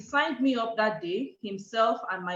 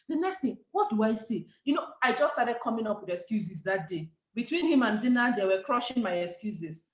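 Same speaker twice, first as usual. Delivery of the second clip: normal talking voice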